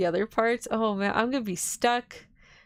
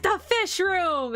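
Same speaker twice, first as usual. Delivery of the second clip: excited; 9 semitones higher, 3 dB louder, much slower than usual. Retold or imitated. Retelling her puzzle realisation.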